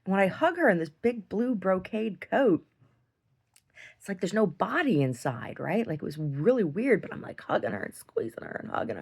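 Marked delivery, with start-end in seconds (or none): none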